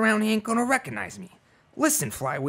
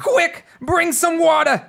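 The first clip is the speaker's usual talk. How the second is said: high, loud, slow order for water; urgent shout; 9 semitones higher, 3 dB louder, slower than usual. Acted. Ordering Slim to fetch water, urgent shout. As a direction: bark the order in a panic. House on fire.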